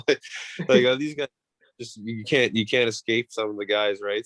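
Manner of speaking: normal talking voice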